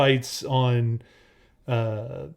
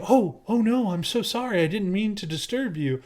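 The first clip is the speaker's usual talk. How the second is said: high, sweetly apologetic imitation; mock-meek apology; 6 semitones higher than usual. Girlfriend in her version of events, mock-meek and high. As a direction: overplay innocent contrition.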